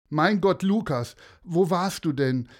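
high, loud relieved call. Acted. Father finds lost Lukas on the beach, relieved loud call. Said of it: "rief eine männliche Stimme".

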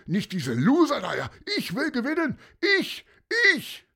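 whiny sore loser. A narrator's reading. Bölker protesting to the announcer, high whiny tantrum. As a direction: whine like a spoiled child demanding the prize. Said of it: "brüllte jemand".

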